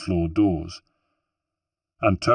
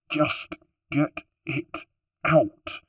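slow and higher, forced through clenched teeth; through gritted teeth; 8 semitones higher, much slower than usual. Acted. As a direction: hold back the pain and grind it out. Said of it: "Rosa gritted her teeth".

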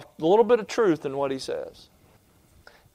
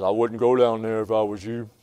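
bee warning; 5 semitones lower than usual. Retold or imitated. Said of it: "He says".

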